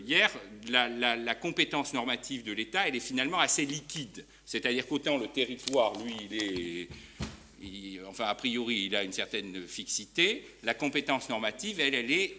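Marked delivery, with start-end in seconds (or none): none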